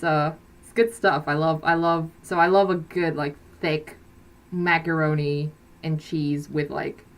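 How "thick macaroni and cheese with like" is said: ordinary talk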